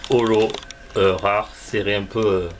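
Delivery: ordinary delivery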